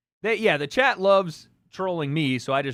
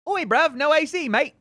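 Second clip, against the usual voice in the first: higher-pitched, badly faked British lilt; mock British accent; 7 semitones higher, 4 dB louder than usual. Streamer teasing UK viewers over air conditioning, mock British accent. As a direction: put on a bad British accent. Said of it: "I can't do British".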